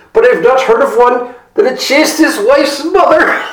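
high, loud, laughing tease; man at the gate mocking Philip through laughter; laughing mockery. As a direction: laugh at him while you speak.

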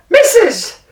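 high, loud, slow calling out; loud staged call. Acted. Jackal calling loudly to his wife for the tiger to hear. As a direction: project so the tiger hears. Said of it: "spoke in a loud voice so that Tiger would hear".

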